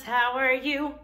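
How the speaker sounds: usual speaking voice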